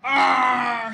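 loud raging shriek; cri de rage; angry scream. A narrator's reading. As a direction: scream out in fury. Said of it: "He screamed and shouted".

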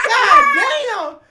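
loud laughing goddamn; cracking up loudly; laughing shout. Real, not acted. Cracking up at bad song.

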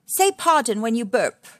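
mild mock scolding of a naughty child; Missus Warthog.

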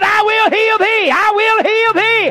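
preaching at full force. Acted. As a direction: hammer each repeat home. Repeated climactic preaching.

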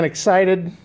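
ordinary talk, nothing marked

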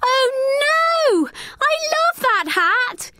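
high, anguished wail; girl wailing as her hat blows away; dismayed cry. A narrator's reading. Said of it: "she cried".

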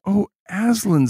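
high, drawn-out lament; storybook despair, lightly acted. A narrator's reading. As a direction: read it as a dismayed plea. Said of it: "said Edmund and Lucy in despairing voices".

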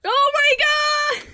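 loud, high, drawn-out shriek of shock; shocked outcry. Real, not acted. Friend watching a cockroach get eaten, shocked shriek. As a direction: cry out in real horror, slowly stretched. Reacting to roach being eaten.

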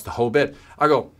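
ordinary delivery

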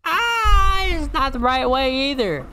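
high, whiny cry of fright; near-crying high whine; panicked wail. Real, not acted. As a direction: wail in despair while fleeing. Fleeing, takes wrong way.

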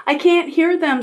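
put-on high-pitched child owl; protesting little owl. A narrator's reading. Little owl objects to mother.

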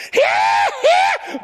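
very high, loud whoop; tickled man's holler. Retold or imitated. Preacher imitates friend's outburst in church, high holler. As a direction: yelp it like someone helplessly tickled. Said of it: "I got him tickled one time".

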